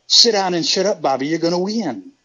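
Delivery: Dusty Rhodes impression. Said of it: "your Dusty Rhodes impersonation is right on point".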